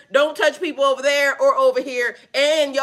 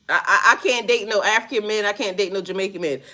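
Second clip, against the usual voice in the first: normal talking voice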